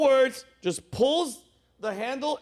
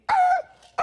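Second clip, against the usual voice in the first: very high, loud squeal; short imitated high noise; imitated landing screech; 5 dB louder than usual. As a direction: squeal like tyres hitting the runway.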